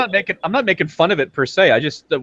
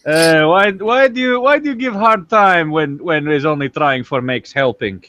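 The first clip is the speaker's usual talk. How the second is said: mock Russian accent. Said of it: "Russian director complains".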